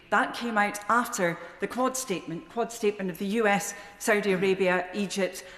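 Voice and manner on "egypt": normal talking voice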